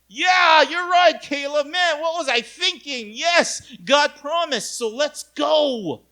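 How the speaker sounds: mock-eager crowd, high and loud; hammed-up cheering agreement. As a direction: gush along like converts won over at once.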